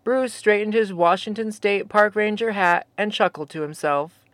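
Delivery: ordinary delivery, nothing marked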